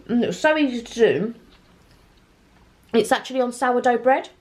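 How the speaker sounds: talking through a mouthful; mouth full. Real, not acted. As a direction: speak round a bite of pizza. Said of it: "sorry to talk with my mouth full".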